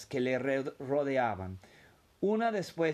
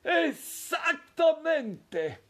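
drawn out, much higher and louder; triumphant exclamation; 14 semitones higher, 4 dB louder, much slower than usual. A narrator's reading. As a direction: stretch it out in triumph. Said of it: "exclamó el abuelo Joe".

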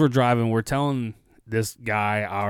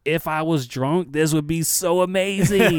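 retold excitement; 5 semitones higher, 3 dB louder than usual. Rider marvelling at the wild police car ride, excited. Telling what they said while riding along.

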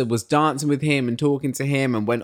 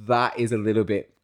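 ordinary talk

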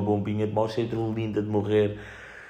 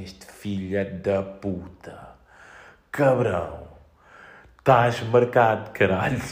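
vengeful cat voice; slower than usual. Pretend. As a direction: growl it like a cat swearing revenge. Voicing the cat's vengeful thoughts.

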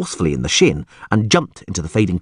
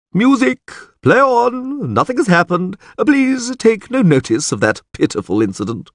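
stiff ceremonial announcing; 12 semitones higher than usual. Acted. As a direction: proclaim it to the whole hall. Chamberlain calls to the ballroom.

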